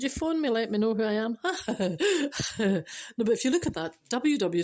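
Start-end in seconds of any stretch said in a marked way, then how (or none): 1.4-3.1 short burst of chuckling; laughing. Real, not acted. Speaker joking about who they are, laughing. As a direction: laugh at your own quip.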